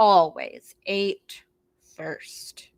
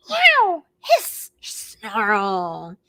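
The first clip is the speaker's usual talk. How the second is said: angry cat yowl; 4 dB louder, much slower than usual. Acted. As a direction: snarl at them like a cornered cat. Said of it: "Violet growled and yowled".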